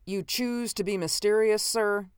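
high-pitched, impatient question. A narrator's reading. Host fed up with visitor's mystery, impatient. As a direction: challenge him testily. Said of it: "I asked impatiently".

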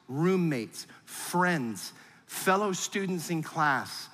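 ordinary delivery

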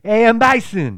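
put-on loud, high French-accented announcement. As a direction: boom it out in a thick French accent. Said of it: "with his fucking French accent".

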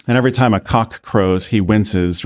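ordinary delivery, nothing marked

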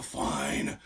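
breathy throwaway whisper; an unbothered, whispered fine; dismissive whisper. Retold or imitated. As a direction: shrug it off under your breath. England shrugs off the losses.

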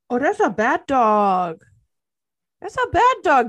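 scolding a dog. Acted. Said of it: "you called that dog a bad dog".

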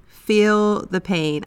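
"Feel" stressed, the three words drawn out slowly with a falling end; a coach repeating the point slowly, leaning on "Feel"; slow, deliberate emphasis. Shown as a demonstration. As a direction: spell it out slowly: "Feel the pain". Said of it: "don't be afraid to feel the pain".

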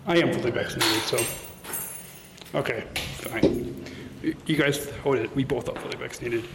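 hushed whisper, voice dropped. Real, not acted. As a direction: whisper so nobody hears. Sneaking into the building, whispering.

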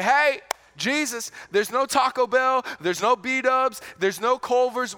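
hammed-up worried disciples pleading with Jesus; excited complaint.

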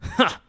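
sharp high mocking "Ha!"; scoffing laugh. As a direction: bark a scornful "Ha!". [name] scoffs.

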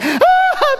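very high squealing scream with a laugh; shriek of joy. Retold or imitated. Audience member shrieks on winning a car. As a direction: squeal like a thrilled fan. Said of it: "People are like".

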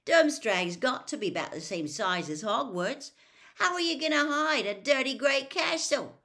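mock the very idea; scoffing disbelief.

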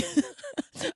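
shrill burst of real laughter; high squealing laugh. Real, not acted. Laughing at the broke college kid.

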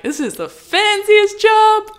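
high, slow, wide-eyed wonder; breathless awe, stretched out; awed. Retold or imitated. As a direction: marvel at the fancy studio. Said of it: "I'm like".

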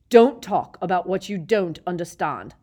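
loud, unhinged teacher; deranged anger. A narrator's reading. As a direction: rant like he's lost it. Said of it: "looking suddenly quite deranged".

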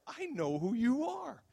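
high, slow sing-out taunt; playful teasing. Retold or imitated. Dreamer teasing the angel in the cart, playfully high and slow. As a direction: tease them with a loud, drawn-out lilt. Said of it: "And so I'm playful".

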